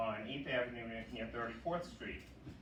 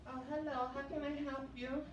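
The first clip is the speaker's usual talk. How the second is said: much higher, lightly voiced polite accent; a prim imagined coworker greeting; expected Colombian coworker; 14 semitones higher than usual. Comic telling of the coworker he expected, in a higher polite voice.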